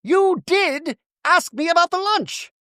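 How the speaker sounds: very high, slow accusation; mock insistence. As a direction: imitate someone insisting, word by word. Acted quarrel, Bronwyn insisting.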